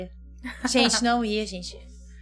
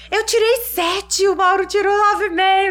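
mocking angry husband; 8 semitones higher, 6 dB louder than usual. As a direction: imitate his sulky outrage, dragging it out. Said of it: "mas ele ficou tão bravo". (imitação exagerada do marido bravo, aguda e lenta)

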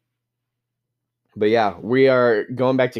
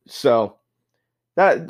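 ordinary delivery

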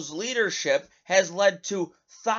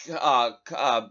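ordinary delivery

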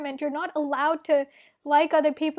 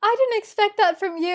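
louder, condescending surprised tone; mock surprise; 3 semitones higher, 5 dB louder than usual. Relatives reacting to her achievement, imitated backhanded surprise. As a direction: imitate someone taken aback by another's success. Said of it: "the tone of surprise".

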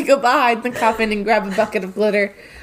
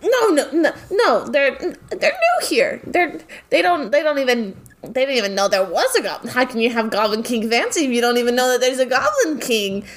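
higher, laughing, rushing protest; high, fast, laughing denial; flustered high Spider; 3 semitones higher than usual. Acted. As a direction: protest in a flustered, giggly rush. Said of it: "To which she goes".

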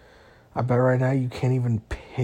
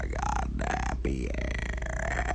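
low droning robot voice; 3 dB quieter than usual. Acted. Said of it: "trying my best to sound like a robot".

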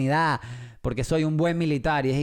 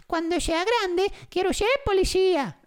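put-on child's voice. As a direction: pipe it up high like a small boy to his mom. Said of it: "Mamá". (niño pequeño hablándole a su mamá, voz muy aguda)

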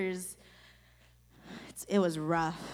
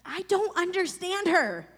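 high, loud and slow, frustrated; angry outburst; 10 semitones higher, 4 dB louder than usual. Retold or imitated. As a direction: blurt it out in anger at her faith. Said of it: "I was angry".

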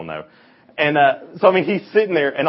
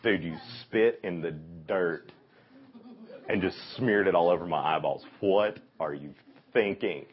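much softer, lower, grumbling under his breath; muttered grumble; 5 semitones lower, 5 dB quieter than usual. Retold or imitated. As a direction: mutter it resentfully to yourself. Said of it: "he's just like".

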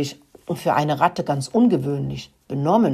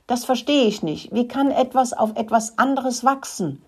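higher-pitched, baffled questioning; puzzled wonder; 6 semitones higher than usual. A narrator's reading. Rat Rudi cannot grasp what mould is, puzzled. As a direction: wonder aloud, pitch lifted. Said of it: "wunderte sich Rudi".

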